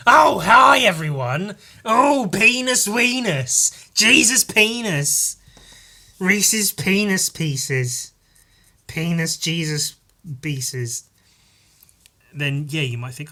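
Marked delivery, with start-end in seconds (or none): 0.1-11.0 loud high-pitched greeting, drawn out; caricature of himself. Retold or imitated. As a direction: shout it high and slow, sending yourself up. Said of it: "you hear, like, me going".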